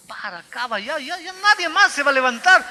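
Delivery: loud, high imitated plea to stop; pleading colleagues. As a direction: mimic the pastors begging him to quit. (súplica imitada, aguda y fuerte)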